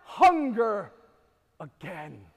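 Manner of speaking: loud, slow, high proclamation; slow shouted preaching; preached shout. Acted. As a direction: thunder out the promise. Jesus quoted at full force.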